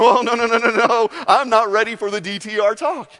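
put-on a nervous boyfriend dodging the talk; panicky boyfriend. Boyfriend dodging the relationship talk, flustered and loud. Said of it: "the boyfriend who are going".

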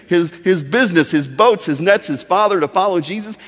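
normal talking voice

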